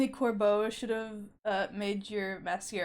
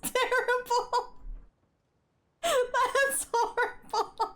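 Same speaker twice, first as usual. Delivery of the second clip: high, slow half laugh, half sob; laugh-cry at a sad scene; real tearful laugh; laughing through tears; 12 semitones higher, much slower than usual. Real, not acted.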